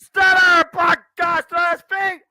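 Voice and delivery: high, loud excited call; excited shout. Real, not acted. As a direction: yell it in disbelief. Live match call.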